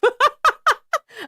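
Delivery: high, helpless burst of giggling; high laughter. Real, not acted. Laughing at a slur in the text.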